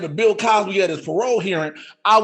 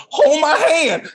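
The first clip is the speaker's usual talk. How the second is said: higher, slow pleading; hammed-up panic request; mock plea; 8 semitones higher, 4 dB louder, slower than usual. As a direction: beg like you're scared. Begging co-host before hot wing.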